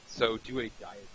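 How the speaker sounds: mic cutting out, voice drops away; faint, fading audio. Real, not acted. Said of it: "you've just faded out".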